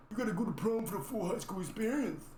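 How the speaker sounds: high, mocking pushy voice; mocking hype voice. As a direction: parody the people pushing prom.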